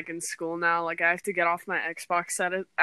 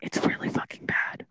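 whispering. Real, not acted. Confiding a blunt opinion.

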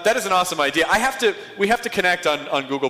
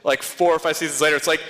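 usual speaking voice

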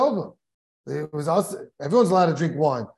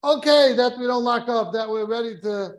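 preaching; 5 semitones higher than usual. Acted. Preacher drives the moral home.

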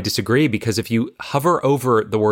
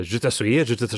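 speaking French. Shown as a demonstration. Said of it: "say something in French".

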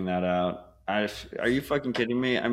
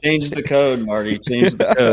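mock demand; 4 semitones higher, 10 dB louder than usual. Mock chant at opponents.

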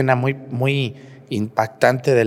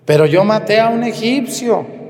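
pleading Moses; 10 semitones higher, 6 dB louder than usual. Retold or imitated. Moses objects to God.

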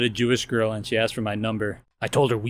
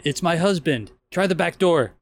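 higher, mildly alarmed acted warning; urgent warning; 7 semitones higher, 4 dB louder than usual. Woman warns her lover the husband is home, urgent. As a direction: play a woman caught out, lightly. Acted panicked wife in joke.

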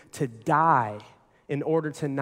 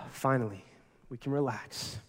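soft, lower, sighing with relief; relieved sigh; 4 semitones lower, 9 dB quieter than usual. Retold or imitated. Couple finally settling into the hot tub, relieved sigh. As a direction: breathe out and sink into the tub. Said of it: "we're like".

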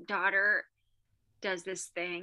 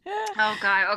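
normal talking voice

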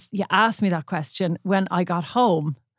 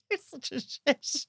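slow, soft, higher fond jab; mock insult; 6 semitones higher, 9 dB quieter, slower than usual. Real, not acted. Wife teasing husband after his joke, soft fond mock insult. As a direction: tease him gently and slowly.

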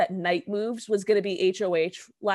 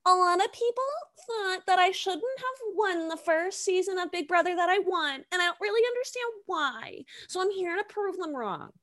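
high, put-on mimicking voice; Nicole; 9 semitones higher than usual. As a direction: recite her intro in a high mock voice. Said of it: "her intro for the season was".

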